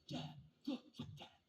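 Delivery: put-on hushed, breathless kid nudging his dad; son's excited whisper. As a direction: tug at Dad's sleeve in a whisper. Son spots Maserati at red light.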